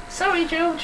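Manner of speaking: high put-on mum apology; prim high mother's voice saying sorry; imitating mum. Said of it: "She'd just be like".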